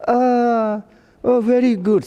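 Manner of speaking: put-on high-pitched old-person reply; old person talking. Old person replying to a greeting, high elderly voice. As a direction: answer as an old one, pitched well up. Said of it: "some old, okay, it's the same as, like, me".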